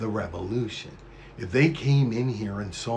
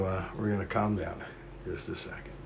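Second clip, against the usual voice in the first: softer, lower self-calming voice; 4 semitones lower, 10 dB quieter than usual. Real, not acted. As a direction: bring it down, settling yourself. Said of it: "I'm getting a little too fired up".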